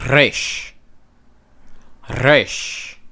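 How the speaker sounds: Resh with a trilled, rolled R; rolling R. Shown as a demonstration. Teacher demonstrating rolled Resh. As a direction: roll the R of Resh. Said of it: "this is a rolling R".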